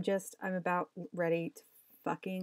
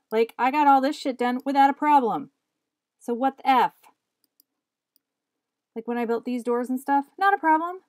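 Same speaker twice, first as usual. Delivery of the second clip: loud, exasperated complaining; loud exasperated outburst; frustrated rant; 4 semitones higher, 12 dB louder than usual. Real, not acted. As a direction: vent at the screen. Rant at broken software.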